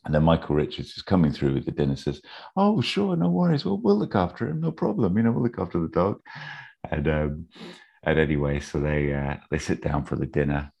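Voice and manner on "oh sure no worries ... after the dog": high-pitched, obliging imitation; eager agreement. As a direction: put on a high voice, all too willing to help. Voices Michael readily agreeing.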